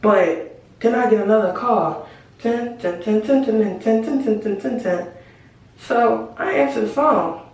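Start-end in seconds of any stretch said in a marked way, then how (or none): 2.4-5.1 dun dun dun suspense. Pretend. Suspense sound effect for a call.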